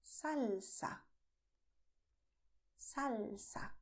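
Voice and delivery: "salsa" drawn out on the L, as in London; clear L. Shown as a demonstration. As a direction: hold the L in "salsa". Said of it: "The same letter L that you have in London".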